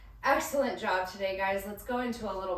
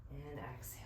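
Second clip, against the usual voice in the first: much softer and lower, breathed out; gentle exhale cue; 7 semitones lower, 14 dB quieter, faster than usual. Real, not acted. Instructor guiding the class to breathe out, soft and low. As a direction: let the word go out with the breath.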